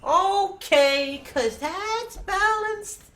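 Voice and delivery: high, drawn-out sarcastic okay. Real, not acted. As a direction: say it slow, dripping sarcasm. Sarcastic complaint about the game.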